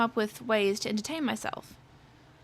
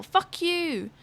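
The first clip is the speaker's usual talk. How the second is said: retold yell; 5 semitones higher than usual. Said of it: "I yell".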